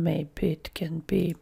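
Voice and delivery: whispered under the breath while working; a quick aside, whispered; muttered whisper. Real, not acted. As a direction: mutter it to yourself as you type. Muttering to self while typing.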